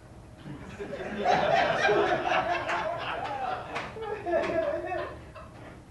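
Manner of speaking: a real high laugh at the absurdity. Real, not acted. Laughing at the recruiter who missed the match. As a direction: burst out laughing at the mix-up.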